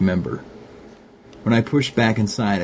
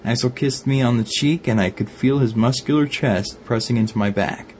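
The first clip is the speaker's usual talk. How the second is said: ordinary delivery, nothing marked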